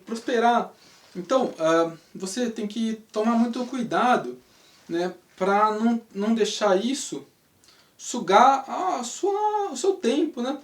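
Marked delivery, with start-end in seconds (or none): none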